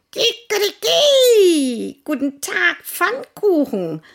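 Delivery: hohe, laute Hahnenstimme; krähend wie ein Gockel; Hahn. Acted. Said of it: "sagte der Gockel". (krähender Gockel)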